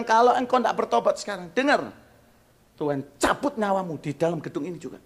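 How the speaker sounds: high, loud threat; stern loud warning. Retold or imitated. Pastor warning the host to repent, loud and stern. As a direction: lay down the final warning.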